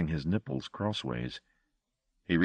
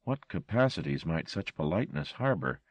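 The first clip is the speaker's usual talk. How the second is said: ordinary delivery, nothing marked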